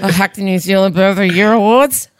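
mock sheepish hacker. Acted hacker repeats his confession.

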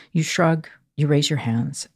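ordinary talk

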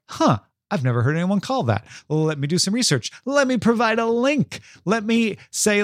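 imitating a curious learner, rising into eagerness; acted open curiosity. Commending a listener who researched, acted curious and increasingly keen. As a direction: play someone puzzled, then keen to research. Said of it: "Katie did what you're supposed to do".

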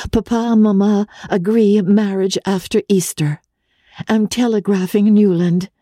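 a hint of May's breathless joy; young fiancée's telegram. A narrator's reading. May telegraphs her news to her cousin, lightly happy.

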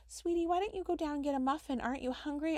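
mock-sweet nurse voice; sweet nurse coaxing. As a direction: coax her gently out the door.

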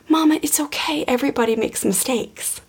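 put-on high, soft little-kid voice; four-year-old child. Little son forgiving his mom for yelling, soft child voice. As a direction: gently imitate a small child reassuring a parent. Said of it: "like, four-year-old".

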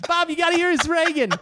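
high-pitched mock summons through laughter; hammed-up delighted guest; excited party guest. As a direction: call a friend over to see the act. Playing guest calling someone over.

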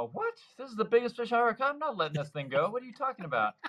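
high, fast protest breaking into a laugh; a kid refusing to let the prize go; indignant disbelief. Retold or imitated.

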